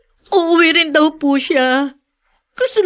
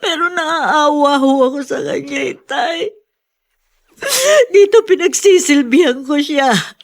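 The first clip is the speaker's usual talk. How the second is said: crying plea. Acted. Daughter pleading about her child.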